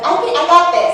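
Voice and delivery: overconfident newcomer, high and loud; cocky actor. Retold or imitated. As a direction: sure of himself, too sure. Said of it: "People coming in with, like".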